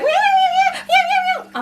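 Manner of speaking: high, frantic imitated meows; cat meowing. Imitating her panicked cat crying at her, high meows. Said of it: "she was like".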